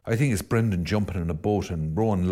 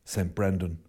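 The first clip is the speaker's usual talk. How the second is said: softer, hushed closing blessing; closing prayer; 5 semitones lower, 5 dB quieter than usual. Real, not acted. Priest closing the sermon with a blessing, softly prayerful. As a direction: bring the sermon to a quiet prayerful end.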